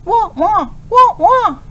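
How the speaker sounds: put-on high yapping like a young puppy; puppy yips. A narrator's reading. Professor showing his puppy how to speak, high yips. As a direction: yip it out like a little pup. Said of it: "yipping like a young puppy".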